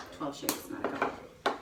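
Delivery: sheepish whispered admission; whispered aside. Real, not acted. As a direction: whisper it like a confession. Confessing overuse as an aside.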